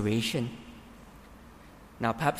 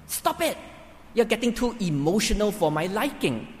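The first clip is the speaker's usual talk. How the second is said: higher, sharp impatient rebuke; impatient scolding; 7 semitones higher than usual. Pretend. Preacher voicing a cold listener snapping at a mourner, impatient. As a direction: snap at them to stop being emotional. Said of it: "you could be communicating".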